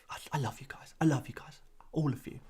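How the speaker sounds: soft unvoiced whisper of affection. Real, not acted. A vlogger thanking his viewers in a fond whisper. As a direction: breathe it to the viewers like a secret.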